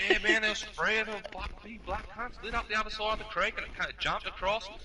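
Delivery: high-pitched, eager imitation of a fibber; yarn-spinning mate. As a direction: sell a tall tale earnestly. Acting Flicky spinning a yarn.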